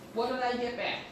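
ordinary delivery

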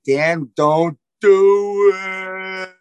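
very slow mock plea with a long-held last word; drawn-out warning. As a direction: stretch it like a slow-motion warning. Acts out warning the husband.

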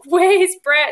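high, loud mock yell; urgent calling out. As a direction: re-enact an insistent call for a missing partner. Repeats re-enacted labour demand for partner.

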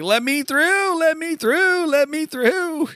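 high, loud, desperate pleading; panicked high voice. Acted. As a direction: push through the crowd, squealing. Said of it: "Titus is like".

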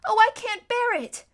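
put-on high, loud wail of a frightened girl; shrilly overwhelmed; distressed cry. A narrator's reading. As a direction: cry out, unable to stand it. Lucy frightened by the pulling.

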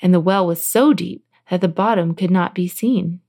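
ordinary delivery

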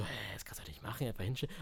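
hushed, whispered disbelief; quick, unvoiced aside to oneself; whispered thought. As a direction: whisper it as a baffled private thought. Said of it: "Und dann dachte ich so".

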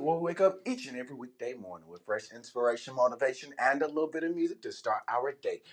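smooth low radio host read; radio host intro. Acted. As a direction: welcome the listeners warmly.

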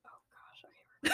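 soft whispered sigh; whispering. Real, not acted. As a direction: whisper it under breath. Whispering before recording.

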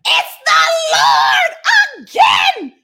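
slow, loud, laughing call; laughing exclamation. Acted. John telling Peter it's Jesus again, laughing shout. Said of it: "John, said to Peter".

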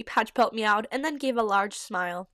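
plain reading voice

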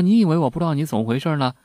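rapid, indignant yelling at the door; young son shouting that he knows her secret; angry scolding. Retold or imitated. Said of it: "就在门外大骂说".

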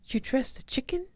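high-pitched disbelief; incredulous question. Acted. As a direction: ask it in astonishment, voice shooting up. Disbelief at the dressed chicken.

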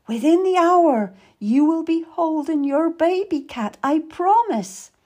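high, insistent promise; urgent, lifted reassurance; emphatic encouragement. A narrator's reading. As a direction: promise it earnestly to keep her going. Meady encourages Kat in labour.

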